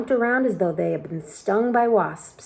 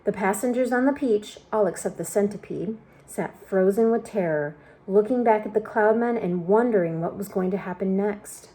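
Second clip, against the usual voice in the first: ordinary delivery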